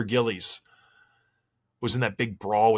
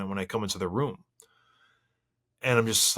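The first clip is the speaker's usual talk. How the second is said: normal talking voice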